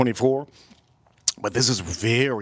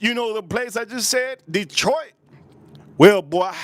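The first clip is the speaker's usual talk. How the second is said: imitated thug, pitched well up; put-on street tough, high and insistent; Detroit thug; 8 semitones higher than usual. Thug correcting a clueless listener, imitated high, pointed voice. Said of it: "one of the thugs from Detroit".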